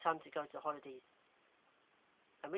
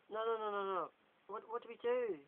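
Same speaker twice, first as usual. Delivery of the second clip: nervous panic; 6 semitones higher than usual. Acted. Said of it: "as he bites his nails".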